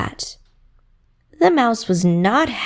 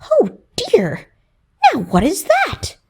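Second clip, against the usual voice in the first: surprised mouse; 7 semitones higher than usual. Acted. Mouse sees reflection.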